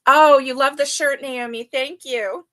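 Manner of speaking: normal talking voice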